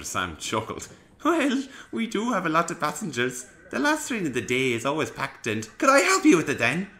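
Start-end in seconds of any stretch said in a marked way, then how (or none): 1.2-5.7 engine voice. Acted. Peter Sam voice. 5.8-6.9 put-on louder, eager young engine; eager interruption. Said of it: "interrupted Ivo".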